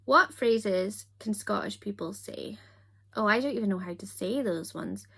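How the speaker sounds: ordinary talk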